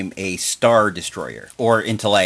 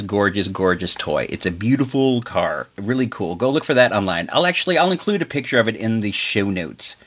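ordinary delivery

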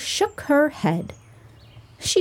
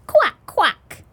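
duck quack; 9 semitones higher than usual. Acted. Duck answers in story.

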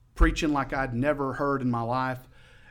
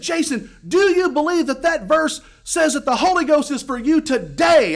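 high, loud fervent challenge; excited shouting; 14 semitones higher, 11 dB louder than usual. Retold or imitated. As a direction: press the question at a shout. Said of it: "he got excited".

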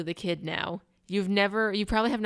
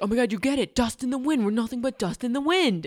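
higher, excited imitation of an amazed man; mock freaking out; 5 semitones higher, 3 dB louder than usual. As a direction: act out his giddy revelation, pitch up. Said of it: "And he's freaking out".

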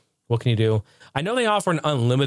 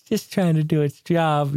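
mimicking wife again; slower than usual. Retelling wife's pity for the cable, slow mock sympathy. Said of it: "She even felt bad for the cable".

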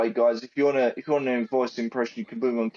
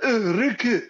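high-pitched attempted Scottish accent; exasperated, put-on Scottish woman; Angie; 7 semitones higher than usual. Retelling Angie groaning at Ricky, rough Scottish accent. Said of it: "I can't do it Scottish, but she's like".

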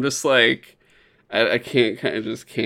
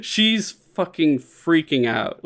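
normal talking voice

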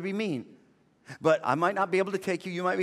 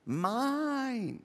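put-on possessive toddler; 6 semitones higher, 5 dB quieter than usual. Imitating the selfish toddler's reply.

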